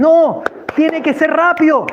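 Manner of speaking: high, insistent cry; urgent shout. Retold or imitated. Man hurrying those coming out slowly, urgent shout. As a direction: cut in and hurry them along. Urging slow people to hurry.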